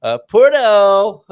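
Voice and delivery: mock American twang on "Puerto", drawn out and loud; American accent. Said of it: "likes my American accent".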